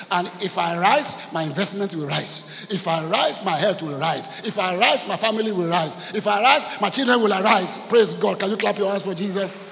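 loud, rhythmic declaration building in force; fervent preaching. Acted.

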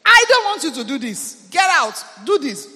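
loud mock rebuke of a servant; imitating a scolding. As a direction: play the madam ordering her house girl out. Acted scolding of house girl.

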